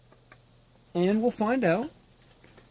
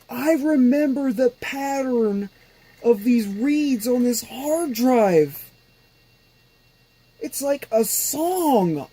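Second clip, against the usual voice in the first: awed excitement; 8 semitones higher than usual. Real, not acted. Hearing the old hard drive again.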